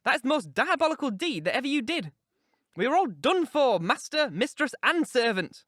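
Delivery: high, agitated, vehement scolding; alarmed, impassioned outburst; passionate. A narrator's reading. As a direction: reproach him frantically, pitched high. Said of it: "I said passionately".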